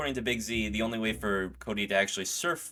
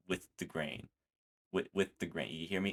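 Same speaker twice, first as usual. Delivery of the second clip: gentle instruction; 3 semitones lower, 8 dB quieter than usual. Retold or imitated. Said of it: "Big Z's giving out tips where he's like".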